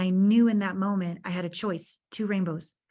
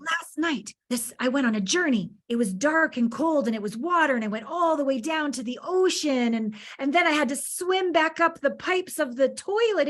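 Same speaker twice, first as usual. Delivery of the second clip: higher, louder, spinning a mock adventure tale; playful storytelling to son; 6 semitones higher, 3 dB louder than usual. Mother teasing her son with a mock adventure tale. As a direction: hams up a dramatic journey to tease her son.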